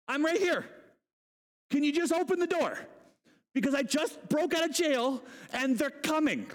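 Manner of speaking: high-pitched, mock-urgent appeal; exasperated pleading. Escaped prisoner to servant girl at gate, exasperated.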